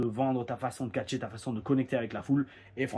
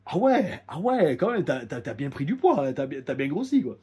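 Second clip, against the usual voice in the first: mock surprise; 3 semitones higher, 6 dB louder than usual. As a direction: act amazed at how much he has gained. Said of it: "sa réaction, c'était".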